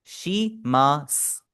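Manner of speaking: शिमास stretched very slowly, final u silent as shimas; drawn-out syllables. Shown as a demonstration. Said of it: "ये इस तरह पढ़ा जाएगा".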